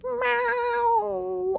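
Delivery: a long, loud, high cat meow. Pretend. Vlogger signing off with a long loud high cat meow. Meowing sign-off.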